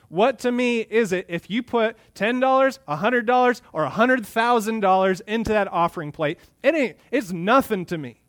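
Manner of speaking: high, loud preaching as God; God rebuking worshippers. Acted. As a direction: thunder it out as an indignant God. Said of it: "this is God speaking through the prophet".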